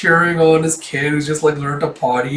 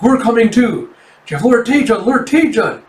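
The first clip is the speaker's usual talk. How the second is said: high, loud eager calls; acted excited villagers' cries; rallying shout; 8 semitones higher, 5 dB louder than usual. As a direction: call out as you rush to help. Villagers rush to help lord.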